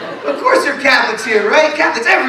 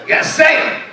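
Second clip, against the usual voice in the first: angry cop; 8 semitones higher than usual. Retold or imitated. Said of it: "never seen a cop get that angry".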